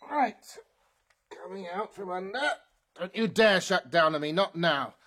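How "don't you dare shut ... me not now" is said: loud, insistent urging. Acted. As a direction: order the machine not to quit. Pleading then commanding robot.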